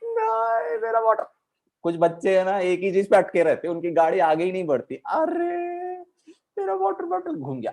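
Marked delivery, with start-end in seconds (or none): 0.0-1.2 put-on crying child wail. Girl refusing papa, high sobbing wail. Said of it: "उसने कहा". 5.0-7.7 put-on high whiny child's wail; crying little girl. Girl telling her papa, high tearful whine.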